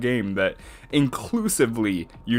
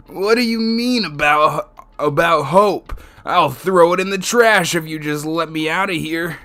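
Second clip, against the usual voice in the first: yelling defiantly, raised high and loud; angry shout; 7 semitones higher, 7 dB louder than usual. Acted. Student confronts Monokuma.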